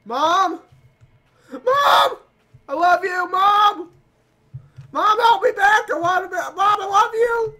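high, loud wailing for mom; hammed-up tearful screaming; panicked child crying out. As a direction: wail like a frightened little kid. Plays child crying for mother.

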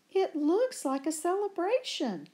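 high, wondering delight. Acted. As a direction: marvel at the garden. Delighted discovery.

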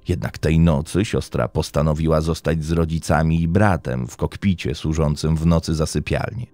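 ordinary delivery

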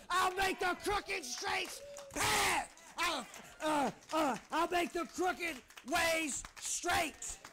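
loud, high preacher's proclamation building to a shout; full-force preaching. Real, not acted. Pastor charging the church to stick to the vision, shouted preaching. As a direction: charge them with rising force, stretching the climax.

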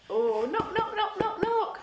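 playful knocking; laughing knock. Pretend. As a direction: knock knock with a giggle.

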